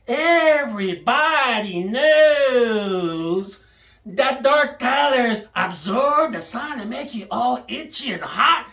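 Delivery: normal talking voice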